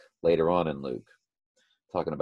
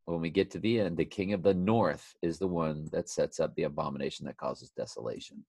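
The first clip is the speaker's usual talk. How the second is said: ordinary talk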